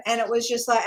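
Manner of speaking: ordinary talk, nothing marked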